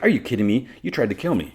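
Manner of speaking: indignant disbelief. Retold or imitated. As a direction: act out an incredulous accusation. Indignant confrontation in retold story.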